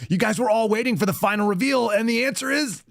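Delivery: high, mock game-show reveal; mock announcer. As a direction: build it up then drop a sheepish sorry.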